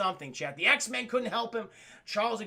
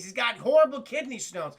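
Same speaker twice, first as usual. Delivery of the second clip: ordinary delivery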